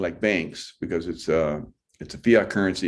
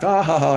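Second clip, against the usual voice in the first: high, louder fake "ha, ha, ha"; mock laughter; 7 semitones higher, 4 dB louder than usual. Mimicking everybody laughing at Biden's story, high mock laugh. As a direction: imitate a crowd laughing along, pitched up. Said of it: "and everybody laughed".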